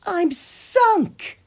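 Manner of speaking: the ground gives way; dismayed Thomas. A narrator's reading. Thomas falls into the mine, a dismayed wail. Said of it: "said Thomas".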